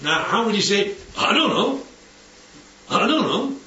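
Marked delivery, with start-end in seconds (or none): 1.2-1.8 cheeky back-talk on I don't know, don't leaned on; mild mock insolence; insolent. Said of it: "It's insolence". 2.9-3.6 second I don't know, still lightly cheeky; a hint of a sulky kid; insolent. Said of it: "It's insolence".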